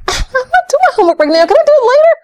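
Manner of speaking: put-on very high pleading child voice; whiny child. Child pushing back on homework, high whiny plea. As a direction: whine like a kid dodging homework.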